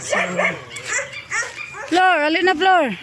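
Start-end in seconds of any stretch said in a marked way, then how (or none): 1.9-2.9 calling out for Flor, pitched up; raised, calling voice. Real, not acted. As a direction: call to a companion, higher voice. Calling out to companion.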